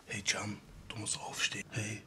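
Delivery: soft whisper. Real, not acted. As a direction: whisper to wake him gently. Waking sleeping friend.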